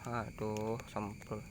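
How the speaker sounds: low, soft muttered curse; cursing under breath. Real, not acted. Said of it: "Saya ngomong kasar".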